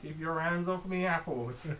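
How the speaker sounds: guard the apples in Somerset; Somerset accent. Retold or imitated. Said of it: "proper Somerset accent".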